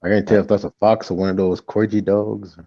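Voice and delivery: ordinary delivery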